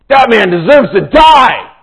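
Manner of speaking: a king thundering a death sentence; righteous anger. Retold or imitated. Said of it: "in his righteous anger".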